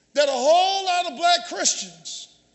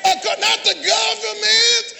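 loud, raised preacher's cadence; full-force preaching; 4 semitones higher, 4 dB louder than usual. Real, not acted. Preacher rejecting reparations before the congregation, full-force preaching. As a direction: drive each phrase home to the congregation.

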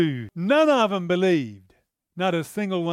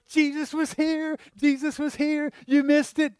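high, eager telling; excited news; 7 semitones higher than usual. Acted. Disciples telling Thomas he missed Jesus, high and eager. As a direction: burst out with the news, higher and thrilled.

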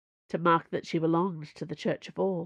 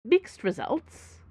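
slow, higher, tight reply; drawn-out, grudging answer; through gritted teeth; 6 semitones higher, slower than usual. A narrator's reading. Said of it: "said the agent through gritted teeth".

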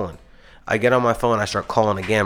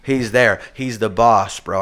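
ordinary talk, nothing marked

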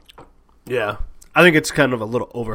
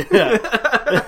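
laughing; 12 semitones higher, 4 dB louder than usual. Real, not acted. Laughing at the story.

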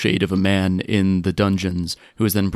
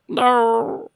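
high, drawn-out wail; acted, anguished high cry; broken no. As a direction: let a tiny plea stretch out in despair. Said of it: "that broken no".

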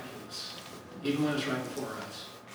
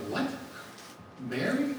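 incredulous; 6 semitones higher, 5 dB quieter than usual. Pretend. Heaven staff surprised at choice.

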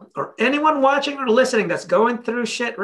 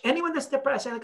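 ordinary talk

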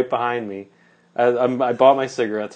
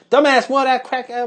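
high, loud mock tirade dissolving into gibberish; imitated screaming rant; 13 semitones higher, 6 dB louder than usual. Woman still screaming as the narrator rolls up the window. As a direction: screech insults on and on. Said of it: "like, screaming".

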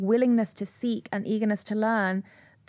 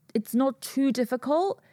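usual speaking voice

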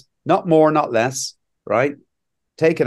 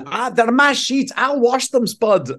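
high, slow, mock-motherly Scottish mum; mother's voice from Trainspotting; 10 semitones higher, slower than usual. [name] quoting Spud's mum about the ruined sheets, high and slow. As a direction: plead like Spud's mum over her sheets. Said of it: "mother is, is putting".

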